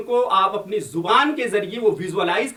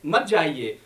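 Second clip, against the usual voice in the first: ordinary talk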